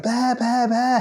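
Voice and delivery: high-pitched mock fan clamour; crazed fans. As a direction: call out like frantic fans, voice way up. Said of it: "crazy fucking Bear crazy people".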